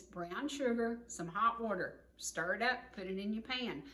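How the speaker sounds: ordinary delivery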